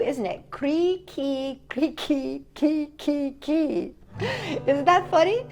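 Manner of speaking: plain reading voice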